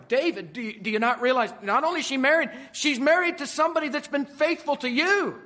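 loud, high, driving sermon voice; preaching at full force. Acted. Preacher pressing the congregation hard, loud and high.

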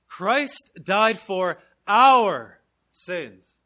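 every word drawn out, loud; slow emphatic verse. Shown as a demonstration. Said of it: "just emphasizing different points".

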